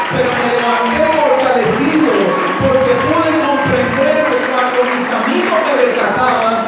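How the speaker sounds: loud, fervent sermon climax; loud triumphant preaching; preaching at full force. Acted. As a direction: preach it out strong to the congregation.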